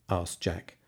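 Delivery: ordinary delivery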